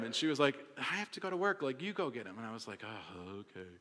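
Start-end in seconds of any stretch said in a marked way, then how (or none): 0.8-2.4 hushed retold refusal; imitating reluctant wife. Retold bedtime argument, wife refusing. 2.8-3.7 very low, soft grudging surrender; hammed-up defeated agreement; mock reluctant resignation. Retold giving in to get up.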